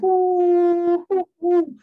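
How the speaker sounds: high falsetto "ooh" hoots, loud; hyped whooping. Retold or imitated. Fan reacts to the three wives comeback, loud high whoop.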